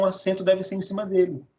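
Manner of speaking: ordinary delivery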